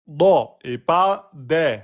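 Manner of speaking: Da full, de thin, slowly; slow contrast of heavy Da and thin de; contrast demo. Shown as a demonstration. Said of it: "On dira da".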